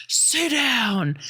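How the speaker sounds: high-pitched growled command, volume held back; mock scream. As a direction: imitate a man screaming an order. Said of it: "he just screams".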